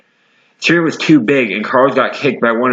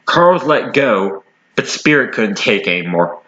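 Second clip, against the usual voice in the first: ordinary delivery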